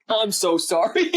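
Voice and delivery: parent's overdone fake apology, mocking; mock sorry. Shown as a demonstration. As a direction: drag out an insincere sorry. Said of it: "don't be like".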